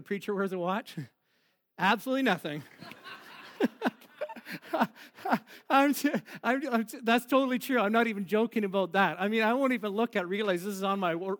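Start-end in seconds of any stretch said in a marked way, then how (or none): none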